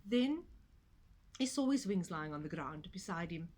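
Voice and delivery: ordinary delivery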